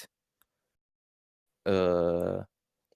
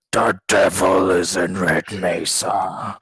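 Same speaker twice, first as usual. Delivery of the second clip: slow, booming demon voice, much louder; demonic layered voice; 10 dB louder, slower than usual. Shown as a demonstration. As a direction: roar it out slowly like a demon. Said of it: "Just give us a little slice".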